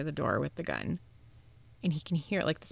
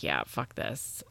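lower, slow fed-up brush-off; 9 semitones lower, 4 dB quieter than usual. Retold or imitated. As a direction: give up on it, lower and slower. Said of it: "he's just like".

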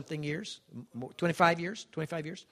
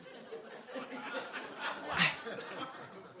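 choked laugh; 11 semitones higher, 6 dB quieter than usual. Real, not acted. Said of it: "little catch in the throat".

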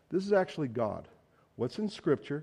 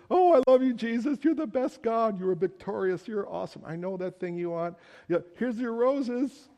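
mock gushing; 8 semitones higher than usual. Mocking believers' flattery of God.

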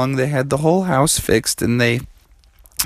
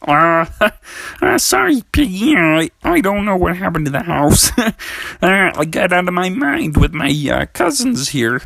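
sheepish, higher frog voice; Kermie; 5 semitones higher than usual. Acted. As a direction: stammer an apology with a nervous chuckle. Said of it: "And Kermie was like".

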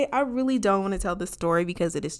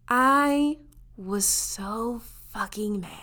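usual speaking voice

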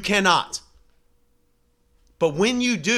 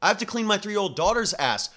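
normal talking voice

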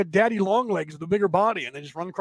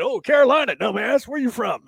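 higher and louder teasing put-down; mocking retort; 6 semitones higher, 6 dB louder than usual. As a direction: scoff at the outsider. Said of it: "everybody that I know is like".

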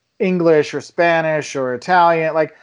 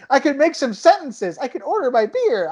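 retold excitement; 8 semitones higher than usual. Retells amazed language return.

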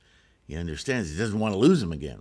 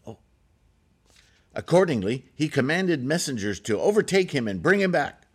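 usual speaking voice

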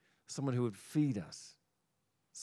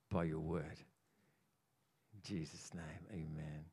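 prayer; 4 semitones lower than usual. Acted. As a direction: pray gently, letting the end drop to a breath. Opening prayer before the sermon.

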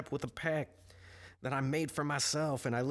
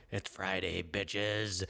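demonic voice; 5 semitones lower, much slower than usual. Retold or imitated. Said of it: "to just this demonic".

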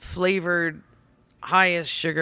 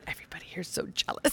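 whispered fast, teasing aside; stage whisper; 14 dB quieter, faster than usual. Retold or imitated. Onstage between verses, teasing the crowd: fast stage whisper. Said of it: "I said".

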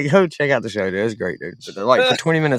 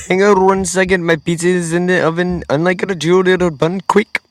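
higher-pitched mock Indian accent; imitated Indian accent; 7 semitones higher than usual. Telling the caller to hold on, put-on Indian accent. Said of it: "with the same accent he called in with".

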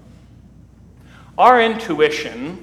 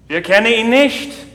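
calling through the door; 5 semitones higher, 4 dB louder than usual. Retold or imitated. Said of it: "the monk calls through the door".

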